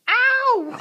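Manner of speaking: sharp high yelp of real pain. Real, not acted. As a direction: cry out when bitten. Puppy bites her.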